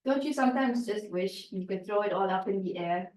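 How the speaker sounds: ordinary delivery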